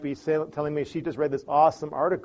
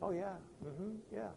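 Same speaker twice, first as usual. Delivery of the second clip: placating relative; 2 semitones higher, 16 dB quieter than usual. Retold or imitated. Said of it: "This relative will go".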